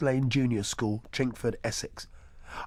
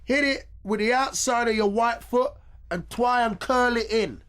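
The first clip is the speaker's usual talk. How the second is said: high, loud coaching shout; shouted instruction; 11 semitones higher, 5 dB louder than usual. Acted. Coach shouting a tip to David, high and loud. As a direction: yell it across the pitch to the boy. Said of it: "I shouted".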